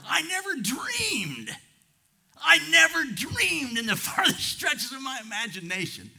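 very high preacher's shout; soaring, impassioned pulpit cry; amazed exclamation. Real, not acted. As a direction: cry it out, stretching DREAMED. Preacher exclaims at climax of testimony.

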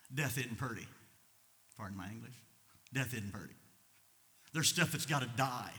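usual speaking voice

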